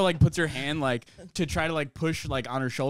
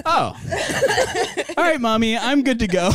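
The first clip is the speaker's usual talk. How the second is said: put-on suddenly fine girl; 6 semitones higher than usual. Handcuffed girl to her mom, mock all-better voice. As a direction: act out cheerful instant recovery. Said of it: "she was just like".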